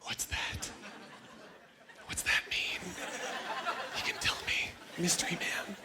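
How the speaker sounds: soft, flirty half-whispered tease. Retold or imitated. Woman coaxing his nickname out, flirty whisper. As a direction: whisper to him seductively. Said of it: "she was like".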